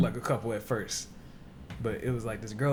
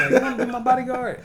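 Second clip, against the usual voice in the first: put-on high loud girly squeal; 12 semitones higher, 10 dB louder than usual. Repeating the girl's line.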